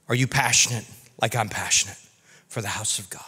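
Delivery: low, fading almost to a whisper; hushed appeal. Retold or imitated. Jesus asking his own disciples, hushed appeal. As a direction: let the question sink softly away.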